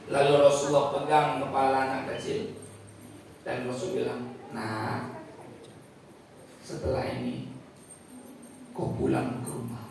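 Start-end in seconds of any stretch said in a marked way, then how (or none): 4.5-9.9 low, soft, tender voice; gentle refusal. Acted. The Prophet gently telling the boy to go home, soft and low. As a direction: send him home kindly.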